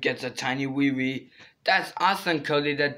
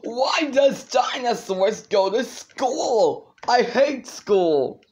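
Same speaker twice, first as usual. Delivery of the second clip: whiny complaining; 5 semitones higher, 4 dB louder, slower than usual. Pretend.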